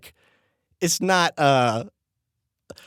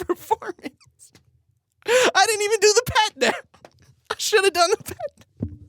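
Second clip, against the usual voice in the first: much higher, squealing through giggles; laughing mock outrage. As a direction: squeal your inner panic between laughs. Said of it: "And in my head, I'm like".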